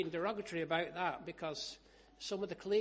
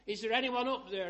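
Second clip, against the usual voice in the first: loud, higher cry for help; retold shout; 6 semitones higher, 10 dB louder than usual. Man hanging from a root over a drop, shouting. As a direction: call up the cliff for rescue. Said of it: "And he shouted".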